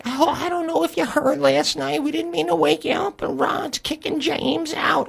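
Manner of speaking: soft, very high falsetto; thin falsetto lady voice, slowing at the end; imitated Paula. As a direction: mimic a screechy-sweet neighbor woman on the phone. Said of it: "this voice that [name]'s got".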